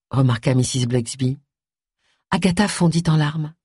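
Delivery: ordinary delivery, nothing marked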